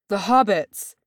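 high, loud cry of alarm. A narrator's reading. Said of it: "cried Gimli".

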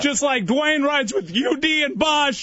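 rally the crowd, all pumped; hyped shout. Pretend.

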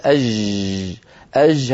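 أج with a soft, Damascus-style fricative jeem; soft jeem demo. Shown as a demonstration. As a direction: let the jeem run like zh in أج. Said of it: "هذه رخوة".

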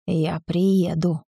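slow hoarse rasp. A narrator's reading. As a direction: rasp it out slowly. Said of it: "прохрипел мужчина". (хрипло и медленно)

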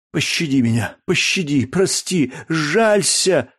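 high, mounting plea for mercy; pleading cry. A narrator's reading. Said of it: "восклицал бедняк". (умоляя о пощаде, всё выше и отчаяннее)

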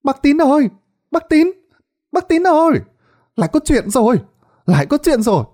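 high, loud shouting of Bác Tín's name; calling out in alarm. Acted. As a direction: yell across the yard in a panic. Neighbour calls out at the gate. (gọi to hốt hoảng, giọng cao)

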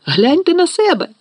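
very high scolding cry; indignant shriek. A narrator's reading. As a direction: shriek at them in outrage. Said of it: "заверещала Герміона".

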